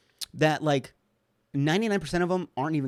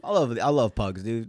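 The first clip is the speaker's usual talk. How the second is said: ordinary talk, nothing marked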